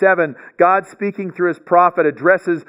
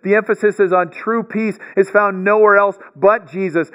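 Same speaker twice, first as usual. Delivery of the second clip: ordinary delivery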